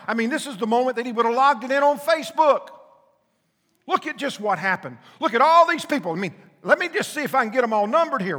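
loud, high, fervent preaching; full-throated pulpit fire; preaching at full force. Acted. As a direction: preach it hard, driving the point home.

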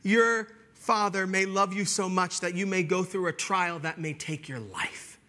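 usual speaking voice

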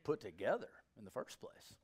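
usual speaking voice

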